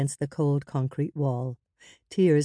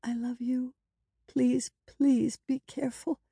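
high, tender plea; anxious pleading woman; 9 semitones higher than usual. A narrator's reading.

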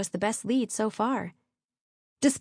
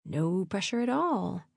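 slow, understated irony; wry thought; 4 dB quieter, slower than usual. A narrator's reading. Narrator before sneaking into the bedroom, wry. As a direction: say it slowly to yourself, wryly.

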